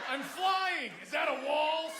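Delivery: high, loud mock superhero cry; hapless superhero. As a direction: call out like a clumsy hero in flight. Clumsy new superhero mid-flight.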